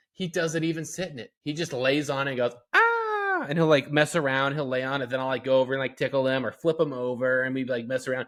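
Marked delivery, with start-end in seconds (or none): 2.7-3.5 put-on high squeaky baby "Ah!" sound; imitated baby squeal. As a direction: mimic a toddler's happy squeal. Baby's noise imitated.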